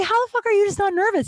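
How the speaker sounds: can't believe how calm he is; high-pitched mock disbelief; incredulous. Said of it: "I was like".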